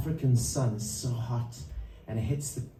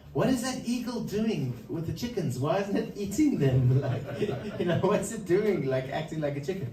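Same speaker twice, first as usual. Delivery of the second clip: higher, amused, disbelieving friend breaking into a laugh; incredulous friend; 6 semitones higher than usual. Retold or imitated. Visiting friend mocks the eagle among the chickens, higher and laughing. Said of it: "and he says".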